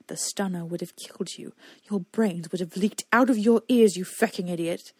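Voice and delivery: loud angry shout back, mostly on the middle. A narrator's reading. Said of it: "Ander shouted back".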